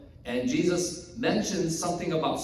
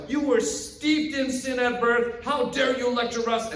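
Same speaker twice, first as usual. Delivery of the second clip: high-pitched, indignant rebuke; set-off outrage; 8 semitones higher, 3 dB louder than usual. Acted. Pharisees set off by the healed man's lecture, outraged. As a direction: lash out, outraged at being lectured. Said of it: "this just sets off the Pharisees".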